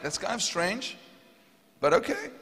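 puzzled, pitch climbing to a high okay; puzzled acceptance. Retold or imitated. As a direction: go along with it, unsure. Hesitant reply to odd instruction.

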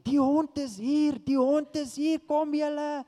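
high, loud farm worker's call; excited shouting. Retold or imitated. Farm workers calling the family out to the found dog, excited shouting. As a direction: shout the news across the farm, drawn out and high. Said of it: "the farm workers start shouting".

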